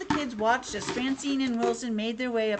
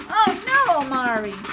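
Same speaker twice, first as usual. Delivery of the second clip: high, loud "oh no" of dread; mock alarm; 4 semitones higher, 8 dB louder than usual. Reader to child as characters peek in, mock alarmed. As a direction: play up the alarm, pitched high.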